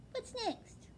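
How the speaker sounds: high, small owlet voice; a young owl, wide-eyed and dazed; little owlet voice. Acted. Owlet telling his father, high and small. Plop's voice in the story.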